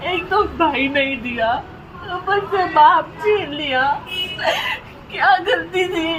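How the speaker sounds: sobbing, wounded protest; real crying. Real, not acted. Fatherless woman asking what her fault was, sobbing. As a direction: cry out the grievance in broken phrases. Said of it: "रो क्यों रही है यार तू".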